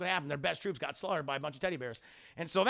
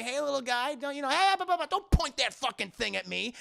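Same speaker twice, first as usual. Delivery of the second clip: higher voice building to annoyance; rising irritation; 7 semitones higher than usual. Acted. Han warning off a spear-pointing Ewok, rising irritation. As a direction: start coaxing, climb into irritated protest.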